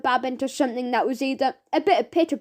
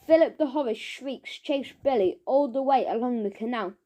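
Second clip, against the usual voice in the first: ordinary delivery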